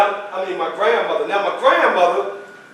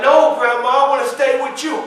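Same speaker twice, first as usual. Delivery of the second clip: put-on pleading child; 6 semitones higher, 4 dB louder than usual.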